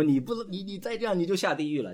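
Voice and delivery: high, heated mock threat; stammering outburst of a pushy believer; imitated yelling. Believer yelling at an unbeliever to convert him, imitated heated shout. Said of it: "我冲着他大声呐喊".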